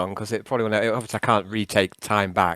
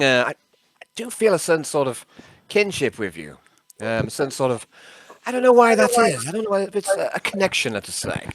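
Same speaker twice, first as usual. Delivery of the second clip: lightly voiced old-chap character, halting; higher-pitched openings that tail off; Nixius, chummy and hesitant; 7 semitones higher than usual. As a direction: ask a pal for a favour, hedging. Plays Nixius calling Yarn.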